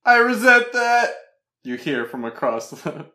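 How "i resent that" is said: high, loud offended outburst; loud, indignant mock outrage; indignant shout. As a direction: yell your protest across the room. Heckled artist shouts from stage.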